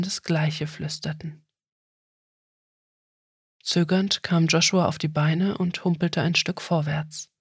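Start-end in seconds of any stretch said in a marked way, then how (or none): none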